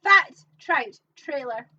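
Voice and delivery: loud, slow, drawn-out announcing; big mock-grand announcement; theatrical announcement. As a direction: proclaim it word by word, loudly. Announces the place name theatrically.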